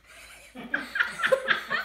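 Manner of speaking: high breathy giggle; squeaky helium laugh. Real, not acted. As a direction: giggle mid song.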